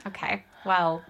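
ordinary delivery

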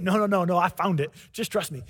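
quiet reassurance. Retold or imitated. Man calms his worried friends.

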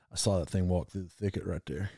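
hushed, low hunter's storytelling voice. Retold or imitated. Hunter telling his buddy about the buck, in a hushed voice. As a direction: lean in and tell it low like a hunter.

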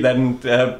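ordinary talk, nothing marked

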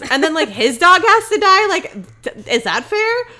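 high-pitched, incredulous protest; indignant. Real, not acted. Host defending the cameraman over his dog, indignant. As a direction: argue the injustice, voice jumping up.

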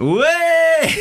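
high, loud mock cheer; imitated crowd cheer. Comedian retelling how the bar crowd reacted, loud high cheer. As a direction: whoop like a delighted audience.

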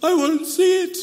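high, hammed-up wail of an old man; mock crying. Old Portuguese man weeping in prayer, hammed-up crying. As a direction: whimper it like a pretend sob, pitched high. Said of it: "But then he started crying".

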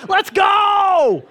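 very high, loud rallying yell; hype shout. Acted. Preacher showing a catchphrase picked up from a friend, hype shout. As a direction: belt it out like a hype man. Imitates younger pastor's catchphrase.

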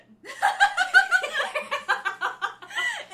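high burst of laughter; high, loud real laugh. Real, not acted. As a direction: crack up.

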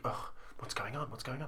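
very soft, nervous murmuring; hushed worried muttering. Retold or imitated. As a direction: whisper your worry to yourself. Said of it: "you're like".

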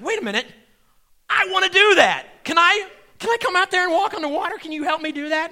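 high, loud, pleading excitement; a loud, eager plea to walk on water; eager Peter. Acted. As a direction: beg to join him, thrilled. Peter eagerly asking Jesus.

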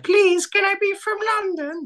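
very high, squeaky begging; pleading mutant. Retold or imitated. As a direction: beg like a tiny mutant. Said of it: "I'm like".

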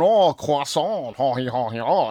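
loud, drawn-out French-accented croissant with hammed-up French laugh; mock a pretentious French speaker, loudly. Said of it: "pretentious than people going".

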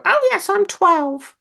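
put-on high, slow, squeaky voice claiming to be young; hammed-up childish squeak; squeaky fake child. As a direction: squeak it out like a grown man pretending to be a kid. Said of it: "Not in that voice".

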